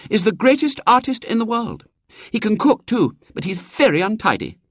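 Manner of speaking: put-on high, imperious girl's voice; a little girl giving orders; bossy little girl. A narrator's reading.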